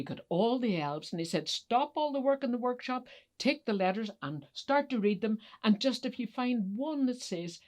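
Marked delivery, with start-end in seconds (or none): 1.5-7.6 urgent orders. Acted. Kringle orders the elves.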